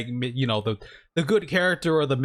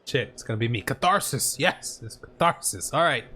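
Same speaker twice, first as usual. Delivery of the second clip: ordinary talk, nothing marked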